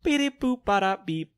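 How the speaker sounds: slow beeping toy robot. Shown as a demonstration. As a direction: beep like a little toy robot. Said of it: "that was way too much".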